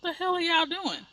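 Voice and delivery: high-pitched exasperated inner reaction; mock exasperation. Retold impatience waiting in line.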